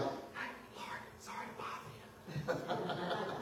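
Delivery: mock polite whisper to a sleeping master; timid whisper. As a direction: whisper it, too timid to wake him. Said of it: "It wasn't like".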